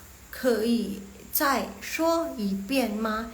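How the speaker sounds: slow, spaced-out Mandarin question; slow model reading. Shown as a demonstration. Teacher shows how to ask for a repeat, slow model reading. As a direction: give each syllable its own beat. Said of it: "Next, yang nomor empat".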